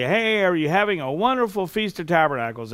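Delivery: song leader. Retold or imitated. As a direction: call out like a song leader rousing the congregation. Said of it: "song leader would get up and say".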